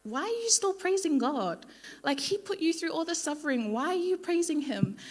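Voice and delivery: lightly voiced scolding wife; Job's wife. Job's wife scolds him for still praising God, mock exasperated. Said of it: "he was like".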